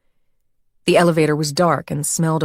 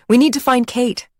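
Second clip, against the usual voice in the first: raised, pressing hurry; urgent hurry; 7 semitones higher, 3 dB louder than usual. Acted. Jenny hurrying Aubrey off the train, urgent. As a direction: push her friend to move.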